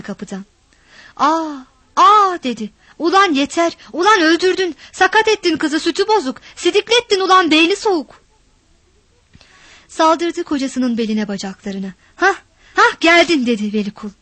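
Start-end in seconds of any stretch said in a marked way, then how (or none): 1.2-2.4 rising alarmed cry, louder on the second; shocked cry. A narrator's reading. Mother finding her daughter beaten, shocked cry. 3.0-8.3 outraged scolding. A narrator's reading. Mother screaming at husband to stop beating the girl, outraged. 12.2-13.5 high, loud gloating jeer. A narrator's reading. Husband gloating as his wife arrives, cruel jeer.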